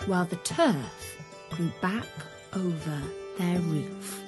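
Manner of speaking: ordinary delivery, nothing marked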